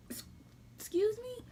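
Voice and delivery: high, stuttered excuse me; offended disbelief. Retold or imitated. To her boyfriend in the story, high mock outrage. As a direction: pretend to be appalled.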